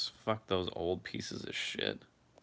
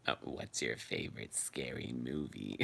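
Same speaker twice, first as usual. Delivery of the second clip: low, drawn-out horror villain voice; creepy, hushed, deep phone-killer voice; Ghostface; 8 semitones lower, 8 dB quieter than usual. Acted. Streamer imitates the Scream killer for a chat question, menacing Ghostface voice. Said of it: "had to do the Ghostface voice".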